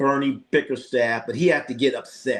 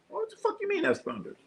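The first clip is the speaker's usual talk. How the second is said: very high, softer disbelieving voice; mock incredulous squeal; 7 dB quieter than usual. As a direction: squeak out the retold disbelief in falsetto. Retells baffled reaction to being told.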